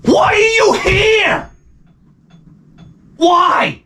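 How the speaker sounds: very high, drawn-out comic shout; exasperated cry. Retold or imitated. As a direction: wail it in disbelief at the newcomer. Exasperated question to the newcomer.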